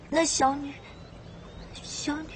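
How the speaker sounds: tearful, halting plea; on the verge of tears. Acted. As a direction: falter and fade as if about to cry. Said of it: "你别哭".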